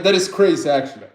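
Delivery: usual speaking voice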